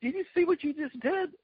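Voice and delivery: high-pitched, amazed mimicry of a thrilled opponent; excited yelling. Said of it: "clapping and yelling and going".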